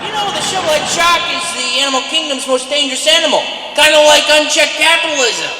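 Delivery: booming, fast comedian patter; loud stage delivery. Acted. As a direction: project it to the back row. On-stage satire bit.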